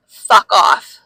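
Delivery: loud, firm mock brush-off; retold rebuff. Woman retelling how she told off men, loud and firm. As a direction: fire it off loudly at a pestering stranger.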